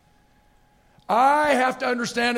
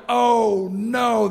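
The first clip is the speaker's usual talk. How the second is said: loud, drawn-out groaned words; mock dismay; 5 dB louder than usual. Passenger facing long cross-country flight, mock dismay. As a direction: stretch it out in loud comic dismay.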